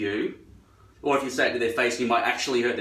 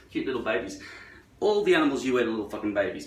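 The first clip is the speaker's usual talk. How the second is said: usual speaking voice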